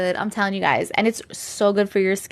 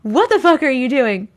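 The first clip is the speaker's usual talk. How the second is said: laughing disbelief. Retold or imitated. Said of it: "he's like".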